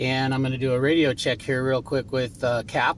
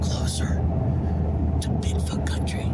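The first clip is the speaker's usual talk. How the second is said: whispering. Acted. Stalking Bigfoot on camera.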